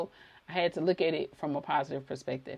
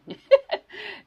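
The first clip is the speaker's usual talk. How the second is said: bright high burst of laughter, louder; 8 dB louder than usual. Real, not acted. Laughing at herself for yapping to viewers, high laugh. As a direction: laugh at yourself, loud and high. Laughs at her own rambling.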